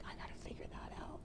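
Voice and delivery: whispered under the breath; soft secret whisper to herself; inner voice whisper. Retold or imitated. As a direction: breathe it like a private thought. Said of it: "that little voice kept coming back".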